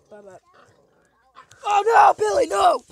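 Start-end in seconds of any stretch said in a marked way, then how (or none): 1.6-2.8 much higher and louder scolding; loud exasperated telling-off; yelling at the dog. Real, not acted. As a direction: shout to stop the dog now.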